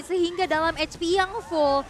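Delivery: ordinary delivery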